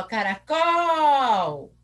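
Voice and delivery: CARACOL with a long, loud COOOL; calling the word. Shown as a demonstration. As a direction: call out CARACOL, holding the final syllable. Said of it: "o caracol lá que a Pró falou".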